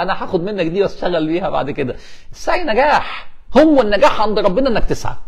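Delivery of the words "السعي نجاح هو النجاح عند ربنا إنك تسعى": high-pitched, delighted proclamation; حماس وإعجاب. Real, not acted. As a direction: proclaim "السعي نجاح" high and emphatic. Repeats the admired phrase excitedly. (ترديد "السعي نجاح" بحماس وصوت مرتفع)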